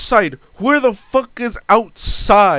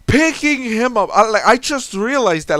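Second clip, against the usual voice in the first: normal talking voice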